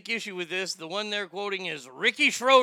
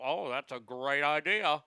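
a hint of a gushing copycat; lightly voiced copycat praising the idea; mock enthusiast; 4 semitones lower than usual. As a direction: put on a breezy fan of the idea. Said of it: "they're gonna go".

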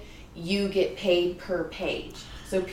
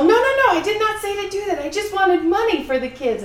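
put-on high, loud, fast English accent; 13 semitones higher, 7 dB louder than usual. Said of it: "all of this is in an English accent".

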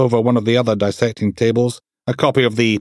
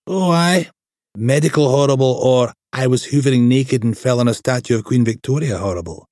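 higher, rough-humoured detective; raised-pitch voice for the crude inspector Steele; brash inspector voice; 4 semitones higher than usual. Acted. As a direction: play the crude, cheeky DI. Steele's voice in story.

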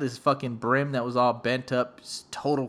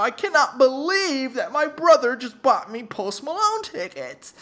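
high, loud, drawn-out mock wail; hammed-up freak-out; 11 semitones higher, 5 dB louder than usual. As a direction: imitate a sister losing it with shock. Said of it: "she's snapping".

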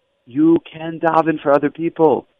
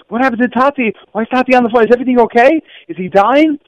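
put-on frightened child; 9 semitones higher than usual. Boy asks mother, frightened.

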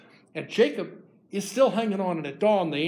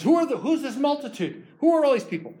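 pitched way up and louder, amazed; astonished question; 9 semitones higher, 5 dB louder than usual. Acted. Esau seeing Jacob's huge family, higher and louder in amazement. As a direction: burst out in surprise at the crowd. Esau greeting brother's crowd.

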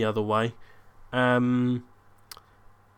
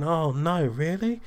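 put-on higher voice with drawn-in reactions; feigned dismay; 5 semitones higher than usual. Son on the phone faking bad news for his date, mock dismay. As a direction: play up the bad news for an eavesdropper. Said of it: "Liz can only hear one side of the conversation, so I'm doing".

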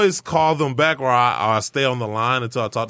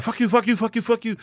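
high-pitched, rapid-fire retold cursing; mock cussing tirade, pitched way up; cussing someone out; 10 semitones higher than usual. Scam caller cussing out the fake cop, high mock tirade. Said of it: "he just started cussing me out".

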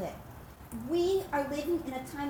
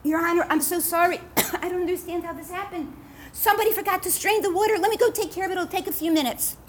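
raised, hurried, nervous apology; flustered apology; 5 semitones higher, 4 dB louder than usual. Acted. Innkeeper apologizing to the landowner, flustered and hurried. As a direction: babble it out in a panic, faster.

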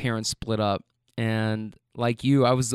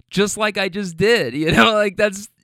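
real catch in the voice, higher and louder; choked up; 6 semitones higher, 5 dB louder than usual. Real, not acted. Driver telling how the talk got to them, choked up. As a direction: voice tightening with emotion. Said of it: "I get kind of emotional saying that".

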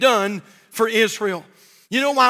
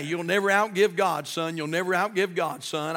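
fatherly advice, soft; 5 semitones lower, 6 dB quieter than usual. Retold or imitated. Dad counsels his young son about giving, softly and low. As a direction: lower and softer, a gentle fatherly counsel. Dad's advice voiced in story.

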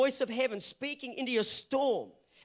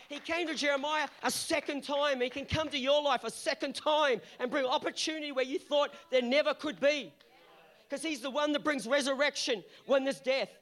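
loud, high preaching climax; rising sermon crescendo; preaching at full force; 5 semitones higher, 4 dB louder than usual. Acted. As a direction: preach it out, building to a shout.

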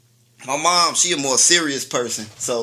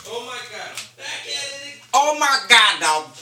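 catchphrase; 11 semitones higher, 5 dB louder than usual. Acted. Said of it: "that's the slogan now".

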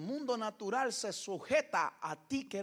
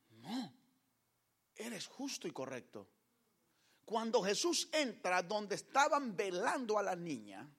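normal talking voice

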